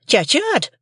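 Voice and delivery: shrill high shrewish scold; scolding sister. A narrator's reading. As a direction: Mrs Joe scolds young Pip, high and shrill. Sister repeats sharply.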